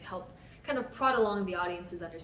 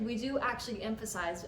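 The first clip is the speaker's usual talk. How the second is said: ordinary talk, nothing marked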